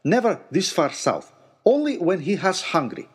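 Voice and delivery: Jim's hint of a Finnish accent; the Finnish guide, lightly accented. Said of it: "said Jim, our Finnish guide".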